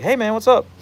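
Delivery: high-pitched buddy greeting; friendly dog voice. Retold or imitated. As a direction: greet like two dogs wagging at a fence. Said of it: "They'll be like".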